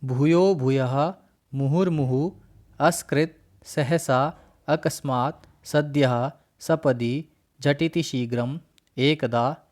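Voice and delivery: each word said separately and clearly, with a pause after it; reciting Sanskrit words. Shown as a demonstration. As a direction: recite the word list carefully for the class. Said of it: "एक बार उदाहरण, उच्चारण कर लेते हैं". (अव्ययों का धीमा, स्पष्ट उच्चारण)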